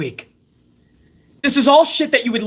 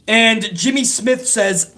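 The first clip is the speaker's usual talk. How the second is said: normal talking voice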